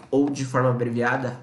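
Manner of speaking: ordinary delivery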